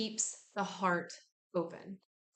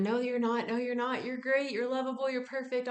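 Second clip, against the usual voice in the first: higher, eager cheering-up; mock reassurance; 4 semitones higher, 3 dB louder than usual. Cheering up a complaining friend, higher eager tone. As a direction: gush reassurance at them a bit higher. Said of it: "we tell them".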